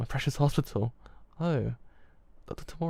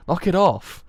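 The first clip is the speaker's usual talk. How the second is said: loud, higher-pitched angry rebuke; angry outburst; 6 semitones higher, 9 dB louder, slower than usual. Acted. Character tells concerned friend to back off, angry outburst. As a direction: cut them off sharply and loudly. Acted quarrel, snapping at friend.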